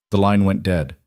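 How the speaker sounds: ordinary delivery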